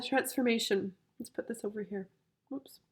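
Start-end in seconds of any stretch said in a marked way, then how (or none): none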